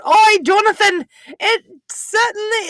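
loud, very high mock Aussie-style accent; high, slowing Aussie-style caricature; put-on Australian-style accent. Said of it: "I was done with accents".

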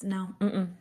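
ordinary talk

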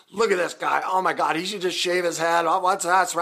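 high, loud jeering mockery; mocking commenters. Retold Facebook commenters jeering at his hair, high and loud. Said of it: "started going off about my hair".

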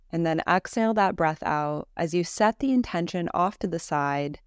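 calm, unhurried Yoga Nidra guide; guided meditation. Acted. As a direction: guide them gently into rest, with long pauses between phrases.

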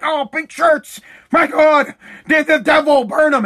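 mock horror. Imitating people who hate pink shirts, mock horror. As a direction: denounce it like a hysterical zealot. Mocking horrified haters of pink.

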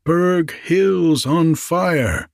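high, drawn-out calling cry; measured, high-pitched call; storybook shout. A narrator's reading. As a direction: call it out slowly, every word stretched. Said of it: "thou must shout three times".

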